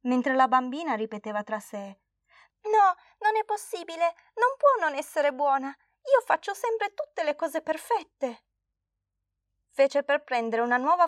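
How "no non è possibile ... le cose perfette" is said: put-on a girl near tears who can't accept it; tearful disbelief. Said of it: "lacrime cominciarono a scenderle".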